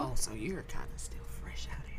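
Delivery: unvoiced aside, under the breath; whispered sly jab. Real, not acted. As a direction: whisper it as a quiet tease. Quips at a late starter.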